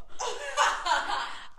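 high burst of laughter; amused laughter. Real, not acted. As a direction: crack up at the story. Laughing at the fight story.

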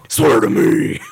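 low, loud growled Batman line; gruff Batman growl. Acted. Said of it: "Batman quote".

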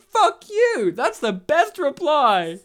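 high, loud burst of delight; excited shout. Retold or imitated. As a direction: shout it out gleefully. Retold outburst at table.

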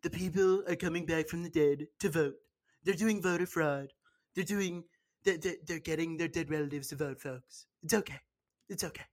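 lightly voiced Trump, dropping soft at the ends; a hint of Trump, trailing off quietly; Trump impression. Mocking the president's rally voice, lightly done. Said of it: "you know how Donald was like".

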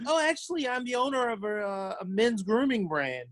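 a light code-switched professional tone; customer service voice. Retold or imitated. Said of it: "I'd be using my work voice".

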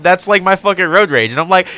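normal talking voice

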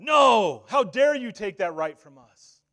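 loud defiant shout; screaming back at God. Pretend. Humanity screaming back at God in outrage, loud shout. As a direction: hurl the words up at God. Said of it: "scream back at God".

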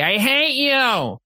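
a child's sulky I hate you, voiced high and slow; father mimicking his kid saying he hates him, high and drawn out; mock child. Child's voice quoted to guest.

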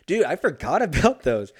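laughing while remembering. Real, not acted. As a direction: laugh through it, loud. Laughs remembering chicken stars.

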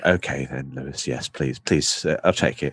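low, soft, meek acceptance; mock meek English politeness; too English to refuse. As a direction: give in politely and sheepishly. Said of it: "I'm still far too English".

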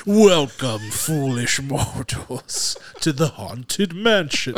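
slow, loud spooky ghost host; slow theatrical villain menace. Pretend. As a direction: drag each word out like a haunted house host. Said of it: "standing at the door of the birthday party going".

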